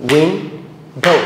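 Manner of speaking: "Window" as two separate beats, উইন and ডো; split into syllables. Shown as a demonstration. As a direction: say "window" slowly with a gap before ডো. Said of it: "তাহলে যদি practice করি".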